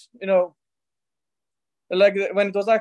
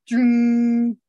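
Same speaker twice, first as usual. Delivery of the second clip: imitated laser "tzoom"; sustained energy-beam noise; laser zap sound. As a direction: hum out a drawn-out laser zap. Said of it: "like a laser".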